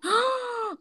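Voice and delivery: high, shocked gasp; big high intake of breath; sharp gasp. Real, not acted. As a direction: gasp in surprise. Shocked at friend's claim.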